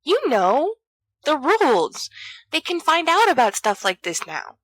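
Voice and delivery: worried friend warning of getting caught; pleading Peter. A narrator's reading.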